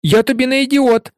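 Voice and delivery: high, indignant retort; wounded, defiant exclamation; indignant cry. A narrator's reading. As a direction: cry out in offended protest. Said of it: "крикнув Невіл".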